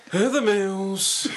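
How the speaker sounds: drawn out very slowly, high and loud; teasing name call. Acted. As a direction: stretch the name out loudly.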